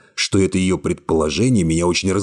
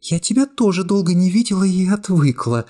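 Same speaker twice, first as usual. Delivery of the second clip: put-on lightly raised young woman voice, cool and calm; young woman's voice; 13 semitones higher than usual. A narrator's reading. Secretary coolly refusing the hero's embrace, high young woman voice. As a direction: voice her higher, calmly distant.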